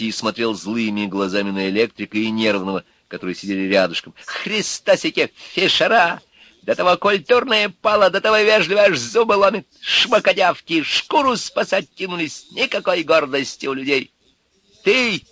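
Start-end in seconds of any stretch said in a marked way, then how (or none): 4.2-14.1 higher, louder contemptuous rant; sneering thug. A narrator's reading. Urka mocking the apologizers, scornful rant. 14.8-15.2 high, sharp yell; shouted. A narrator's reading. Electrician yelling at Urka, sharp shout. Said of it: "крикнул электрик Пахомов".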